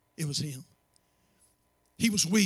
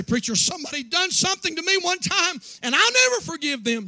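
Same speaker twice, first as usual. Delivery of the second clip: a higher, louder voice of a stubborn church member; mock objecting congregant; 11 semitones higher, 6 dB louder than usual. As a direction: play the grudge-holder in the pew.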